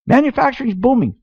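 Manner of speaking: ordinary talk, nothing marked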